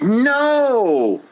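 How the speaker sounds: emphatic no; sharp no. Acted. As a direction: shut it down hard. Cutting off objector.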